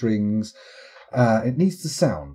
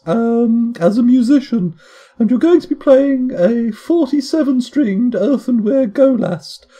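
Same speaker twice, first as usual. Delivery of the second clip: brief the agent in a raised, put-on voice; Diana; 12 semitones higher, 7 dB louder than usual. Handler briefing an agent on his musical cover, high put-on voice. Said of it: "internet's finest impression of Diana".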